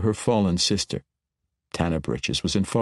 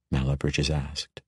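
usual speaking voice

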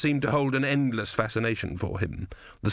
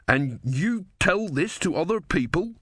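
higher, slow, pressing question; insistent questioning, pitched up; 8 semitones higher than usual. A narrator's reading.

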